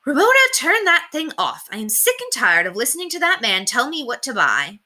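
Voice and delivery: mild scolding mother, sick of it; fed-up mother. A narrator's reading. Mother tells Ramona to turn the TV off, mildly fed up.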